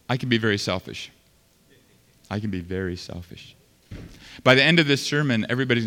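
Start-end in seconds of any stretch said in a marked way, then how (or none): 2.3-3.5 much softer and lower, almost to himself; quiet confession. Real, not acted. Pastor confessing his own selfishness, hushed and low. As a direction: drop it to a hushed, lower admission. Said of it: "repeats confession much softer".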